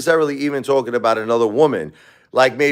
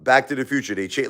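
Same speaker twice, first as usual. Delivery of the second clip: normal talking voice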